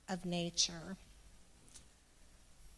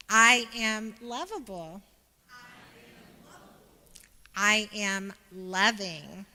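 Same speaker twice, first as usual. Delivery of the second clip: louder, slow and emphatic affirmation; with more conviction; 8 dB louder, slower than usual. Shown as a demonstration. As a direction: step it up and declare each line. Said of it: "let's say it with more conviction this time".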